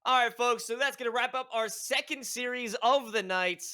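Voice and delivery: loud, upbeat broadcast sign-off; esports announcer. Acted. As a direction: hype it up like a caster. Caster signs off to break.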